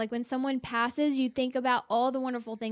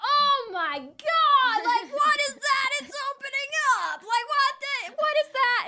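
much higher, loud horrified imitation; dramatic boyfriend; 12 semitones higher, 3 dB louder than usual. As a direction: ham up the boyfriend's shock at the video. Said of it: "he's so dramatic".

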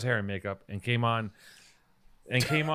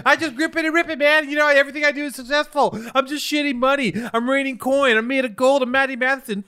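very high, loud braggart impression; mocking Maddie; 14 semitones higher, 8 dB louder than usual. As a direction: shout boasts in a high mock-hype voice. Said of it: "That's you".

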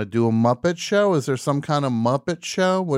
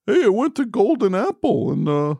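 much higher goofy reviewer voice; high silly voice; 10 semitones higher than usual. Acted. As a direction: play a chirpy show host, pitched way up. Voicing a show host.

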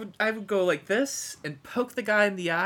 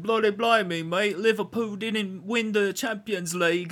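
mock British accent. Robot talking to the man, in a mock British accent. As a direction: play the robot as a cartoon Brit. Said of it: "it was like".

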